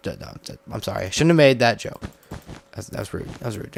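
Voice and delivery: soft, sheepish apology; hushed mock regret; quiet apology. Mock apology after bad joke.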